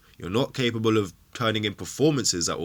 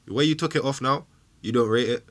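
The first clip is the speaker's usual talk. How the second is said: ordinary talk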